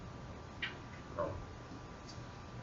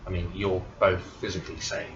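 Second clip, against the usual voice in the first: ordinary talk, nothing marked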